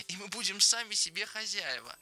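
high, happy proclamation; eager, childlike high voice; joyful. A narrator's reading. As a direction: say it with delight, pitch well up. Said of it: "Ленни радостно засмеялся".

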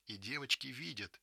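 usual speaking voice

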